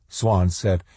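usual speaking voice

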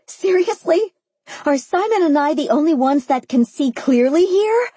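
high, exasperated rant; yelling. A narrator's reading. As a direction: yell at them in disbelief. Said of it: "I yelled once more".

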